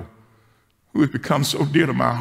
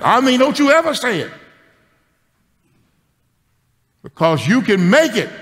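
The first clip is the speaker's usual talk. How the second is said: put-on high, loud child scolding; little girl, hand on hip, telling grandpa off; bossy little girl; 10 semitones higher, 4 dB louder than usual. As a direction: wag a finger like a sassy five-year-old.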